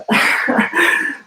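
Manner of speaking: high airy burst of laughter; helpless laugh rising at the end; breathless laugh. Real, not acted. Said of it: "I'm just laughing, guys".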